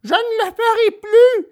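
very high wailing little voice; acted weepy puppet cry; tearful puppet promise. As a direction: wail it like a sorry child. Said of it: "s'écria Pinocchio en pleurant".

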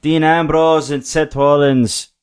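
bad Scottish accent, slow and loud; drawn-out, badly faked Scottish wrestler; Drew. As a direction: attempt a Scottish brogue that slips into Irish. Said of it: "I'll just do a Drew voice".

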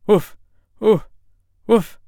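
sharp high barks; three short loud woofs; loud dog bark. Acted. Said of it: "Then he barked, loud".

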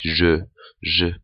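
je as a buzzing "zhuh"; French J. Shown as a demonstration. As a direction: say je with a French zh, twice. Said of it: "French J, like je".

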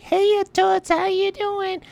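very high cartoon New York accent; brassy falsetto with a heavy New York accent; Harley Quinn. Shown as a demonstration. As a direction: squeal it in heavy New Yorkese. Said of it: "Heavy New York accent".

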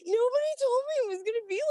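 very high, fast whine; lost young sailor complaining to himself; whiny young self. Retold or imitated.

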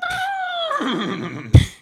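high, drawn-out throat noise; put-on tic noise. Shown as a demonstration. Man showing a noise to make before speaking, put-on tic. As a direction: demonstrate an odd vocal tic before speaking. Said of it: "I'm gonna start doing that".

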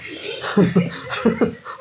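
high, airy giggle; real giggle at your own story; amused laugh. Real, not acted. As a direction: laugh lightly at the memory. Amused at height difference.

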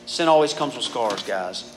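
ordinary talk, nothing marked

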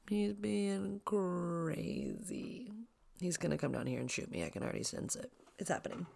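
mock-scared whisper about son with Nerf gun. Real, not acted. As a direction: whisper it as if hiding from him. Fearing nerf gun attack.

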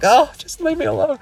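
high whiny mock of himself being woken by kids; groggy groan. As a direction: play the tired dad begging to be left alone. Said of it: "I'm like".

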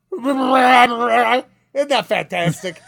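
loud, mock-proud old teacher. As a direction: praise the din like a hammy New York bandleader.

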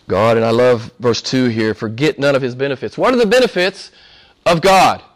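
ordinary delivery

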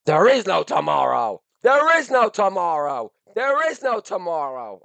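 put-on high, loud chanting yell of a six-year-old; kid's yell. Dad mimicking his son yelling during a workout, high and loud. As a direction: shout it over and over like an excited kid. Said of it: "my son yells".

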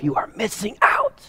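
hushed dramatic aside; whisper. Real, not acted. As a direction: lean in and whisper it. Preacher drops to whisper.

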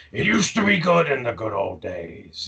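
lightly voiced gloomy villain; wistful Megatron. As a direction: reminisce like a washed-up old guy. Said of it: "he's like".

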